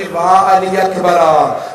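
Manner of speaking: loud, slow drawn-out mourning cry; crying wail. A narrator's reading. Women mourning the martyrs after release, loud slow wail. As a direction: stretch the name out in a loud wail of grief. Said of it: "रो रो के कह रही थी".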